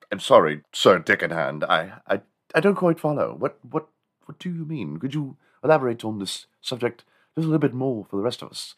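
puzzled British official, stammering politely; hesitant, put-on English gentleman; English meeting chairman. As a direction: play a baffled chairman with a mock English accent. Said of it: "there was this town meeting".

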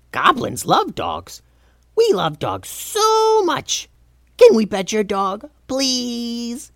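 high goblin gushing, stretched key words; excited Goblin King. A narrator's reading. Steve pleading with Calvin to pet his dog, excited high goblin. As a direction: beg like a besotted little goblin.

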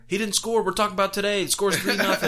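high, loud mocking comeback; dismissive retort. Brother scoffing at the fan, high mocking retort. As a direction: scoff at him, half laughing. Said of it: "he was like".

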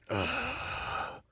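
soft, voiceless groan after a drink; grimacing exhale. Acted. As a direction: let out a wincing breath after strong liquor. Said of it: "A grimace and a".

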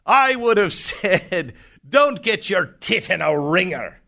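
high-pitched imitated Scottish accent. Son telling off the woman in a Scottish accent. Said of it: "He does a really good Scottish accent".

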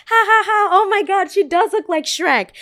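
high, loud, mocking cackle; mocking girls. High, loud, mocking girls. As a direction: friends laughing along with the boys.

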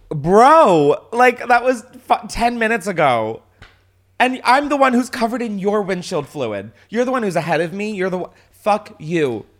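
loud, high, heated rant at him; angry yelling at driver. Retold or imitated. Telling off the guy who glared in his mirror, loud and angry. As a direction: let him have it, voice up and loud. Confronting tailgated driver at light.

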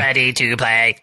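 robot voice. Retold or imitated. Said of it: "I love the robot".

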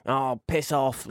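slow, moody brush-off; lightly acted sulky dismissal; Harry Potter. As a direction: drag it out like a grumpy sulk. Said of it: "in a mood with everyone".

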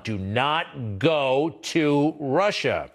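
each word spelled out slowly and firmly; slow emphatic warning. Acted. Host to viewers, hammering home the travel warning, slow and firm. Restating the State Department warning.

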